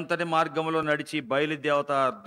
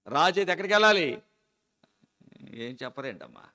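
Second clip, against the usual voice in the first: ordinary talk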